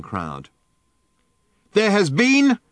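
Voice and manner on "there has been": high, loud megaphone voice; megaphone bark. A narrator's reading. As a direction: bark it through a loudhailer. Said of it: "barked the megaphone".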